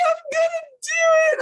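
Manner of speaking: high, cracking sob-shout of a promise; real crying. Real, not acted. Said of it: "I'm just crying".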